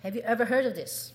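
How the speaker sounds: normal talking voice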